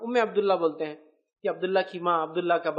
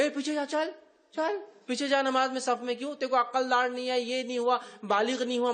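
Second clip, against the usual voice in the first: higher, bossy mock scolding; mock scolding elder; 4 semitones higher than usual. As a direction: shoo the boy off like a grumpy elder. Imitating elders shooing boys away. (بزرگ کی ڈانٹ کی نقل، اونچی آواز میں)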